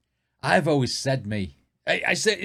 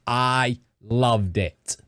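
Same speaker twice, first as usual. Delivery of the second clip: "I loved it" very slow, drawn out in a mock down-south accent; 5 semitones lower, much slower than usual. Shown as a demonstration. Explaining how to do the accent, slow mock down-south drawl. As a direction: drag "loved" out like an Australian judge. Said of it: "That's how you do the, the accent of down south".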